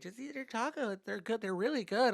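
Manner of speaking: high-pitched girlfriend imitation; coaxing girlfriend. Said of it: "she's like".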